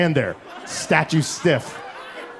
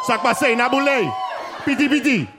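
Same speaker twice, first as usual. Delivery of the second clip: mock Haitian Creole; 7 semitones higher than usual. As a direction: rattle off fake Haitian Creole like the dad. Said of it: "I just said a bunch of gibberish".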